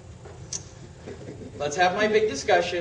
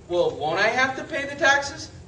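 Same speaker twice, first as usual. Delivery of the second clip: ordinary talk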